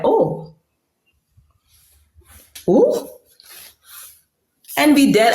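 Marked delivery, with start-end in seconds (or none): 0.0-3.1 surprised oh. Retold or imitated. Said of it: "I was like".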